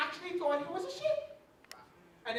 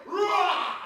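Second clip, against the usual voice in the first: higher, loud mock roar; daddy lion roar; 5 semitones higher, 5 dB louder than usual. Daddy lion calling his cub, loud mock roar. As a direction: roar again to wake the cub. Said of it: "Then the daddy lion said".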